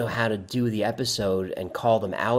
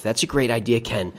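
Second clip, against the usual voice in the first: normal talking voice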